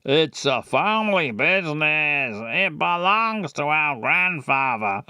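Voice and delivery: lightly put-on brother voice; mild singsong character voice; Tom talking like Adam. Shown as a demonstration. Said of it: "he talks like Adam".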